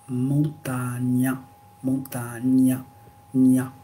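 montagna with gn as ny, mon-ta-nya; Italian gn. Shown as a demonstration. Teacher modelling Italian gn sound, slowly. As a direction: gn in montagna said like French gne. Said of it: "Pareil en italien".

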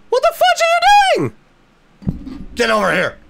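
panicked yell across the farm; shrieking at cows. Real, not acted. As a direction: scream at them to come back. Yelling at escaping cows.

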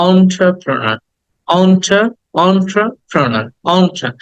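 slow "entrepreneur" as "on-tra-pra-na"; correct pronunciation. Shown as a demonstration. Teacher shows how it should sound, slow "entrepreneur" starting "on-tra". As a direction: split "entrepreneur", opening on a round "on". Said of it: "it should be pronounced like".

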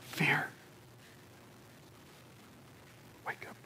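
whispered, word by word; barely voiced, with a long pause; hushed warning. Real, not acted.

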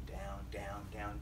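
low, soft, dropping voice; sinking down. A narrator's reading. Storyteller follows Jonah deep into the water, sinking hushed voice.

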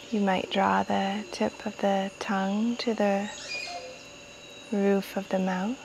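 calm meditation-guide voice with long pauses; guided meditation. Real, not acted. Guiding listeners to welcome jungle sounds, calm meditation voice. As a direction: ease listeners inward, unhurried, phrase by phrase.

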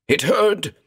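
frantic high raving. Acted. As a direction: rave it out in terror. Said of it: "raved Callum".

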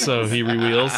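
Russian accent, w for v; Russian w for v, "reveals"; Chekhov accent. Shown as a demonstration. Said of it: "He can't say his V's".

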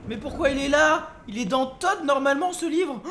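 loud, high, incredulous protest; astonished outcry. Real, not acted. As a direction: exclaim in loud disbelief. Astonished at book in the game. (stupéfaction bruyante)

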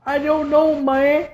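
usual speaking voice

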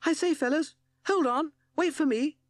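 a tiny toad piping up to catch his friends; little toad calling after his friends; Timid Toad calling. Acted. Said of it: "heard Timid Toad's voice call".

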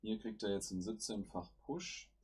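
usual speaking voice

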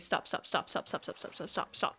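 soft, rapid-fire voiceless repeats; urgent whisper. Real, not acted. Streamer begging Lucky to hold still, urgent whisper.

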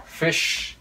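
Fish with a long hissed sh at the end; final SH sounded. Shown as a demonstration. Teacher shows learners the S H in Fish, final sh held. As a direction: let the SH in Fish ring out. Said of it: "Các bạn thấy chữ S H".

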